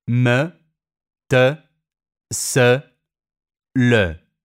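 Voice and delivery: "Me", "te", "se" and "le" ending on a rounded e; rounded e in short words. Shown as a demonstration. Teacher demonstrating final e, rounded and clear. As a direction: round the lips at the end of each short word. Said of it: "alla fine di parole monosillabiche".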